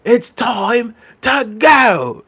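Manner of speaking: slow, high, drawn-out imitation again; mock catchphrase. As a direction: stretch every word high and slow. Repeating the catchphrase.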